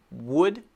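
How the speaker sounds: "Would" with lips rounding and releasing the W; correct W. Shown as a demonstration. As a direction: start "Would" with a clear lip W, rising. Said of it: "the first one is right".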